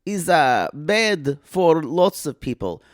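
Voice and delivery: put-on halting comic Eastern European accent; cousin Olga. Visiting cousin on the covid year, swooping accented voice.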